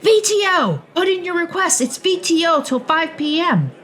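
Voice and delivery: high, loud announcing call; shouting friend. Acted. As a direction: yell it across the cafeteria. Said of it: "shouts the three letters".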